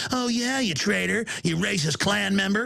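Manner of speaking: mocking accuser. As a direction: jeer at him in a mock self-righteous tone. Mocking a scoffing member of the public.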